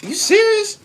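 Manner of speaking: squeaky high "You serious?"; hammed-up disbelief; high incredulity. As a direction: play it shocked and innocent. Mock disbelief at being cussed.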